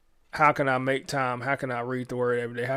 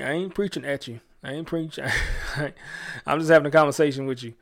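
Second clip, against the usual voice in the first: usual speaking voice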